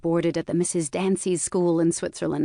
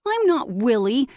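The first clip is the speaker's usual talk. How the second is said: put-on high, indignant child's protest; childish outburst of indignation, rising at the end; indignant cry; 6 semitones higher, 4 dB louder than usual. A narrator's reading. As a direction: cry it out higher, offended. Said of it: "his playmate cried indignantly".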